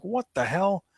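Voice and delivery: high-pitched exasperated exclamation; incredulous, exasperated; retold outraged disbelief. Acts out own outraged reaction.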